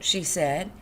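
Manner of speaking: plain reading voice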